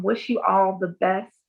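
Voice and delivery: normal talking voice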